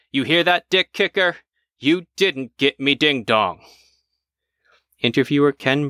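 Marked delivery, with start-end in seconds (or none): 0.1-1.4 high, pitched-up jeering call to the attacker; old man taunting his attacker, pitched up high. Acted. 1.8-3.6 slow, drawn-out gloat with didn't leaned on; old man slowly rubbing it in; gloating taunt. Acted. Jared taunting the attacker.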